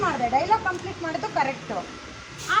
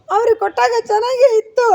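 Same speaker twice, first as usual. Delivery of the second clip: high, very loud protest; feed the line loudly to the child actor; demonstrated complaint; 4 semitones higher, 10 dB louder than usual. Shown as a demonstration. Director models complaint before take.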